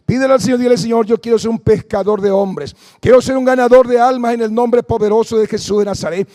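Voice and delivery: loud fervent preaching. Acted. Preacher urging the congregation to pray, fervent. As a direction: preach it with fire to the crowd.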